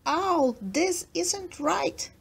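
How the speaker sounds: Rat crying out. A narrator's reading. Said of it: "Rat cried".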